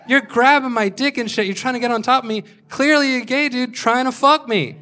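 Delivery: high-pitched, exasperated protest; acted outraged complaint; indignant accusation. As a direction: confront him, voice pushed up high. Acted confrontation after being groped.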